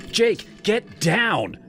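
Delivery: alarmed plea; pleading shout. Acted. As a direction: yell up at him. He climbs the fence.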